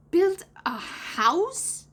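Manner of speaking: high, very slow disbelief; put-on boy, drawn-out shocked question; incredulous exclamation. A narrator's reading. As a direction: stretch each word and swoop up in astonishment. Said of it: "exclaimed John".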